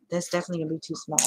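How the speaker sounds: fast throwaway repeat; rushed repeat. Real, not acted. Crafter confirming the palm maker is too small, fast. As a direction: hurry through the confirmation. Repeats verdict faster second time.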